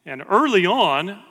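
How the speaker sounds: normal talking voice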